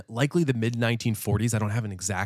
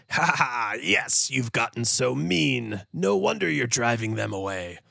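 mustache twirling villain. Retold or imitated. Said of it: "Mister Coffee Nerves is there saying".

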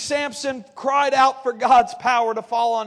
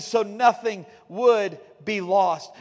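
slow emphatic refrain; 3 semitones lower, slower than usual. Acted. Sermon refrain slowed again.

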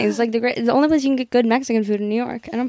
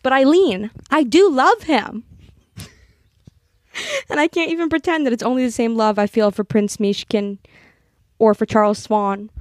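earnest literary reading, lightly performed; reading a novel aloud; 3 semitones lower than usual. Acted. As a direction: read the passage like a heartfelt confession. Reads a novel passage aloud.

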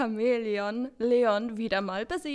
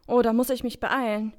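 ordinary talk

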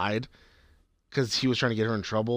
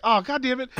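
high thrilled oh God damn it; gleeful exclamation; 10 semitones higher than usual. Retold or imitated. Recalling watching the puke scene, high excited exclaim. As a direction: cry it out like the scene delighted you. His reaction to the puking scene.

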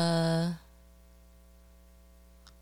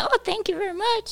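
much higher, sugary thank you; mock sweet thanks; 3 dB quieter than usual. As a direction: thank them in a high mock-polite voice. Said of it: "But I said".

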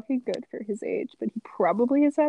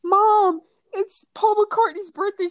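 loud, squealing high call to Mom; very excited announcement; 12 semitones higher, 7 dB louder than usual. Retold or imitated. Retelling how they told mom the news, squealing excitement. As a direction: shout it like thrilling fan news. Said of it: "So I told my mom".

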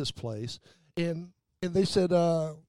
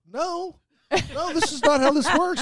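higher, louder mock protest; imitating a waiter putting his foot down; indignant staff; 9 semitones higher, 4 dB louder than usual. Restaurant staff scolding a rude customer, mock protest. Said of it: "And they're like".

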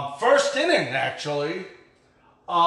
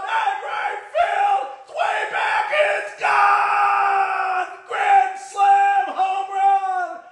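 soaring high shouted home run call; play-by-play call; 13 semitones higher, 4 dB louder, much slower than usual. Retold or imitated. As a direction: call the grand slam like a frantic radio announcer. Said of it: "I'll actually do play-by-play while I'm at the game".

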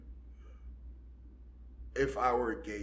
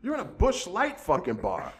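higher, indignant rant at partiers; raised, incredulous scolding; mock outraged yell; 8 semitones higher than usual. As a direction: yell it like they've lost their minds. Ranting at birthday partiers.

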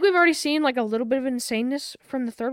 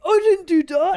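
high, loud whiny denial; Steven voice; 7 semitones higher, 6 dB louder, slower than usual. Retold or imitated. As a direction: play it as flustered Steven. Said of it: "Steven's like".

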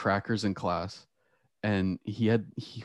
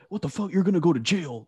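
higher-pitched imitation of overreacting teachers; mock outrage; 8 semitones higher than usual. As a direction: mimic an appalled teacher. Mimics teachers overreacting to a student.

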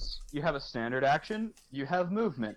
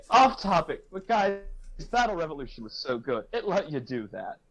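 ordinary delivery

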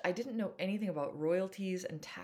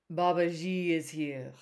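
solemn, louder, otherworldly; intoning; 7 dB louder than usual. A narrator's reading. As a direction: intone like a medium. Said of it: "she intoned".

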